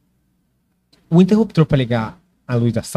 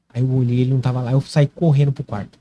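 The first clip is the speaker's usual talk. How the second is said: usual speaking voice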